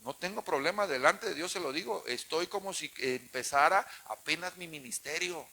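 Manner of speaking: ordinary talk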